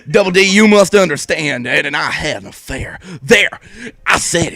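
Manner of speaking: loud dramatic confession. Acted. Confession read aloud.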